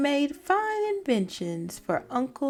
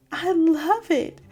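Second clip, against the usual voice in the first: hooted; 6 semitones higher, 6 dB louder than usual. Acted. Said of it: "Fred hooted".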